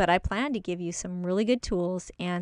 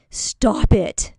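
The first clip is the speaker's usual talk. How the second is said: loud, sharp fatherly stop it; dad's stern command; 8 dB louder than usual. Retold or imitated. Dad scolding his acting-up kid, loud and stern. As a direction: snap it like a strict father. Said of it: "my dad would always say".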